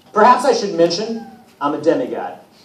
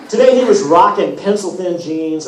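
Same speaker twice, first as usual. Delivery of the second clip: usual speaking voice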